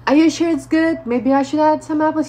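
high, loud, hammed-up fussing; awkward over-eagerness. Speaker to the rapper about his juice, awkwardly eager. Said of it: "the way I said it was just so".

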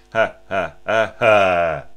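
"ha" pulses pushed from the belly, the last one loud; breath-driven "ha" accents in one air stream; staccato breath pulses on "ha"; diaphragmatic accent. Shown as a demonstration. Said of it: "into a diaphragmatic accent".

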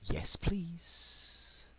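breathless whispered yes please; hushed hopeful whisper. Real, not acted. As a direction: whisper it with fingers crossed. Hushed as he places order.

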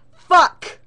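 sudden very loud curse; loud shout. Real, not acted. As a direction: blurt it out in rage. Frogs start again, outburst.

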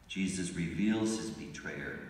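ordinary talk

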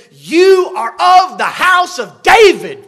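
loud, high, slow prophetic shout; indignant shout. Shown as a demonstration. Isaiah shouts at Ahaz, the house of David. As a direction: shout each word in rebuke. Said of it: "he shouts at him and he says".

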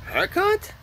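squeaky-high rising question; high-pitched questioning. Real, not acted. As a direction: shoot the voice way up as a question. Questioning his new haircut.

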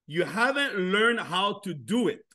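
slow, deliberate English line; English phrase. Shown as a demonstration. To the crowd, slow English answer. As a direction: spell it out slowly in English. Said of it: "la réponse que vous devez leur dire".

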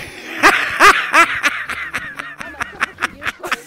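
squealing laugh at his own punchline; high cackling laugh. Real, not acted. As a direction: cackle high after the joke.

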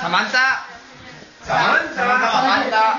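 calling out. Real, not acted. Calling out for Samantha.

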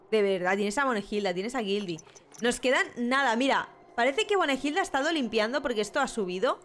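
ordinary talk

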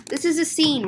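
single fluent run; brisk statement. Acted. Telling customers it is a scene, briskly. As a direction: say it straight through without gaps. Same line in one run.

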